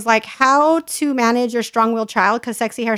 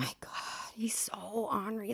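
whispered aside about her son; 19 dB quieter than usual. Real, not acted. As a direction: hush it like a secret complaint.

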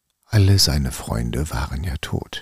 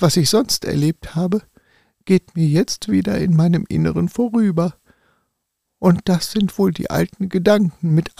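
high, small tin soldier voice; a little toy soldier pouring his heart out; 13 semitones higher than usual. Acted. Tin soldier tells his story.

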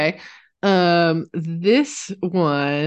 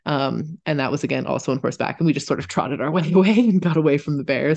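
normal talking voice

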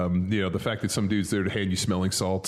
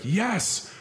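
excited yes; 11 semitones higher than usual. Retold or imitated. Gets handed a smelling salt.

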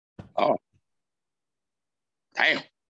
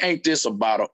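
Charlie Brown voice; 7 semitones lower than usual. Retold or imitated. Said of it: "and then Charlie Brown".

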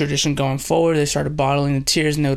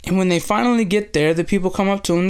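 normal talking voice

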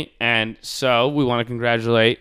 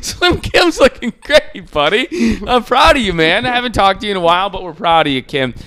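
high, louder gushing praise; proud buddy; 7 semitones higher, 5 dB louder than usual. Acted. Host tells Kim he is proud, gushing high voice. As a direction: beam with mock pride.

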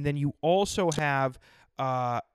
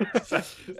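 imitated giggle; 4 semitones higher than usual. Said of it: "She'd be like".

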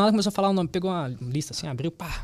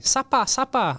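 higher-pitched mock call; lightly hollered place name; calling out destination; 7 semitones higher, 3 dB louder, much slower than usual. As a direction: imitate a man calling out a stop.

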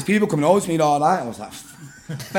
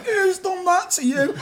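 very high, slow whine; mock tearful wail; 13 semitones higher, slower than usual. Mate crying over what he was seeing, mock tearful wail. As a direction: imitate a distraught, hallucinating mate. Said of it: "crying his eyes out".

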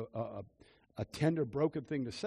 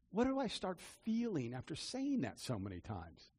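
usual speaking voice

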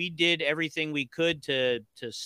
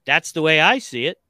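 punchy sign-off catchphrase; signature tagline; 8 dB louder than usual. Shown as a demonstration. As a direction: deliver it like your trademark line. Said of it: "my cool ti-, uh, tagline with".

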